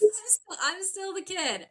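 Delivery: high-pitched, laughing insistence; laughing protest. Real, not acted. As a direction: wave off the title with a high, loud laugh. Laughing protest at being called doctor.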